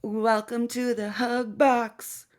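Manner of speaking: high sarcastic welcome; mock the group's cozy tone.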